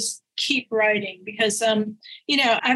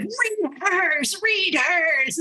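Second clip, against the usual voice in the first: eager urging; 7 semitones higher, much slower than usual. Retold or imitated. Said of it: "my little group could".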